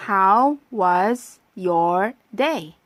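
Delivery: very slow, every word of How was your day stretched; slow practice read. Shown as a demonstration. 학생들이 따라 하도록 천천히 읽어 주는 선생님. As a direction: spell it out slowly for learners. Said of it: "자, 발음해 볼까요?".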